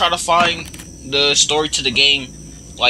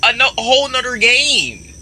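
indignant yelling; 12 semitones higher than usual. Real, not acted. Yelling at character.

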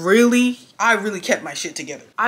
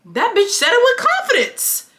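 high-pitched, astonished exclamation; incredulous outrage; 12 semitones higher than usual. Real, not acted. Storyteller stunned that she admitted it so boldly, incredulous.

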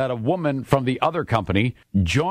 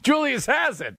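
laughing; 13 semitones higher than usual. Real, not acted. Laughing while talking.

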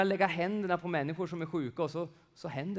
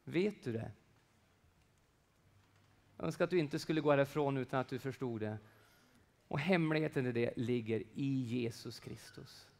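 usual speaking voice

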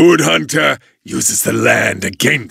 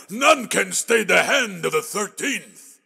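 menacing laugh; 5 semitones higher, 7 dB quieter than usual. Acted. Villain gloats in battle.

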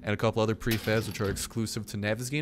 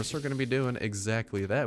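ordinary talk, nothing marked

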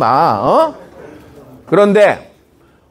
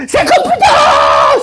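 very high, long drawn-out yell; hammed-up high, slow shriek; demonstrated scream; 3 dB louder, much slower than usual. Shown as a demonstration. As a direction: holler the wish at the parents' door. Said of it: "소리를 지르란 말이야".

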